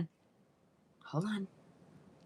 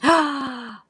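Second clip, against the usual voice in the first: excited gasp; 7 semitones higher, 5 dB louder than usual. Real, not acted. Sees new viewer comment.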